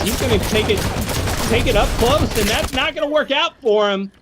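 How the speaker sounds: rising, hyped play-by-play call; excited caster. Real, not acted. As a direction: get pumped as the fight turns. Caster hyped by close fight.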